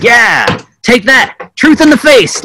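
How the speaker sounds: high, slow, crowing mock triumph; hammed-up gloat at winning an argument. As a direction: crow it like a smug young debater. Retelling his own gloating past self.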